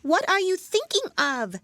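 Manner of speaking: high, loud scolding mouse voice; stern telling-off, pitched far up; severe mouse. A narrator's reading. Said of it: "said the mouse to Alice severely".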